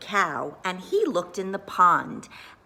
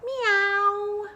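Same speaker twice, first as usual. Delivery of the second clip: high cat meow; 13 semitones higher than usual. A narrator's reading. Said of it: "the dog said".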